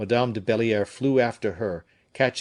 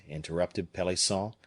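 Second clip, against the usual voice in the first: ordinary delivery, nothing marked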